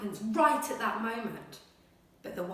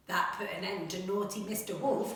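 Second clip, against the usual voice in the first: ordinary talk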